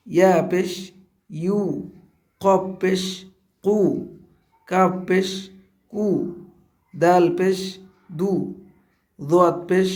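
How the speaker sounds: letter name, পেশ, then a short u syllable like বু; spelling drill with pesh. Shown as a demonstration. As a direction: recite each letter with পেশ and its u sound, pausing between. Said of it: "এইভাবেই আমাদের প্রত্যেকটা অক্ষর সুন্দরভাবে উচ্চারণ করতে হবে".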